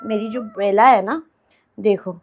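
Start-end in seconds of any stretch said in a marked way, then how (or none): none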